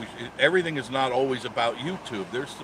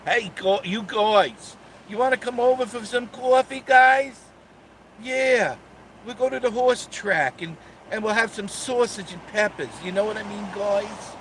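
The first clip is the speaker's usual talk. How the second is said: put-on much higher, loud New York neighbor accent; 9 semitones higher, 5 dB louder than usual. Inviting the guys for coffee, hammed-up New York accent. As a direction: play a chatty New York guy with a New York accent. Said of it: "I actually talk like that".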